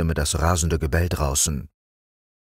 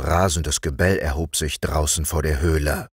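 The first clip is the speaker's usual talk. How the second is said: ordinary delivery, nothing marked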